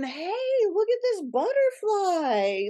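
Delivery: put-on high, delighted child voice; a kid pointing at something amazing; child's wonder. A child showing off a butterfly, high and full of wonder. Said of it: "they're still going".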